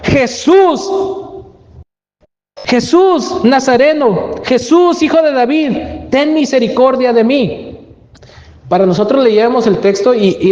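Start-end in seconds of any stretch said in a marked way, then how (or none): 0.0-7.7 much higher, crying out for mercy; pleading shout of the blind man; desperate cry. Retold or imitated. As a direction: call out to Jesus like a blind beggar. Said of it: "Él clamó".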